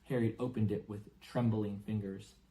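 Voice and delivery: plain reading voice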